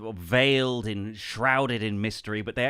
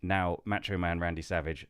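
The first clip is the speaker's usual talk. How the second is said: usual speaking voice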